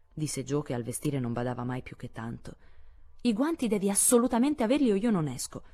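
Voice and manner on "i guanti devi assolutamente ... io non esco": higher, firm and final; firm declaration. A narrator's reading. Meg insisting her sister must wear gloves, firm and higher. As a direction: lay down the rule, no arguing. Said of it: "dichiarò netto Meg".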